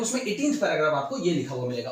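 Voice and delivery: ordinary talk, nothing marked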